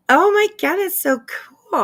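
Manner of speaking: gasp, then a high squeal of delight; real thrill, voice jumping up; excited gasp. Real, not acted. Streamer learns her stream is featured, squealing with delight.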